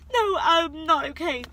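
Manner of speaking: no, I'm not okay said crying; crying denial. Real, not acted. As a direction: insist through tears. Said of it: "I'm really trying not to cry".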